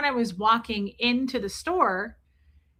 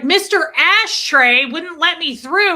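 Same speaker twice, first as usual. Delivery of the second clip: loud complaint; 7 semitones higher, 10 dB louder than usual. Retold or imitated. Loudly telling the owner.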